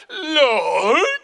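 high, loud, laughing mock devotion; mock reverence. Preacher mocks a wife calling her husband Lord, laughing and loud. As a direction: ham up a worshipful Lord.

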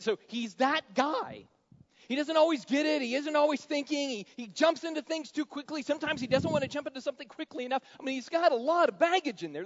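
high, loud preacher's build-up; impassioned preaching. Acted. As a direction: pour it out at full force from the pulpit. Preaching at full force about Peter.

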